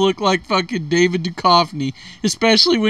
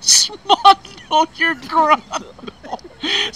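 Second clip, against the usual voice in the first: slow, drawn-out disbelief; incredulous repeat; 6 semitones higher, much slower than usual. Real, not acted. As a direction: stretch each syllable in disbelief. Incredulous slow repeat of silly phrase.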